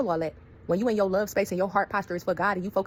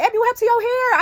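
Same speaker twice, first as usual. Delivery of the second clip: much higher, louder mock shock; shocked coworkers; 9 dB louder than usual. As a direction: gasp at the ruined hair. Coworkers shocked at her hair.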